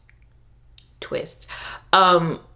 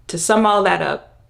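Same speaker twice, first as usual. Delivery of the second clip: ordinary talk, nothing marked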